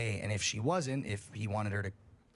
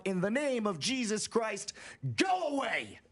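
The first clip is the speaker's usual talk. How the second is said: retold shout; 11 semitones higher than usual. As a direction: shout the creature away with a rifle in hand. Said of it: "says something to the effect of".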